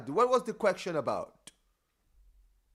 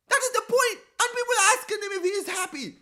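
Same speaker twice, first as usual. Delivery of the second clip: exasperated rant; 14 semitones higher, 6 dB louder than usual. Real, not acted. Exasperated rant about coach's schedule.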